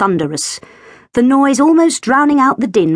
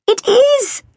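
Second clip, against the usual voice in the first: much higher delighted squeal. A narrator's reading. Katy cheering up Sandy about her ring, squealing. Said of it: "squealed Katy".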